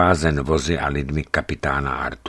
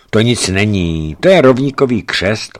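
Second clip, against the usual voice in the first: reassuring; 4 semitones higher, 6 dB louder than usual. A narrator's reading. Captain reassures laughingly.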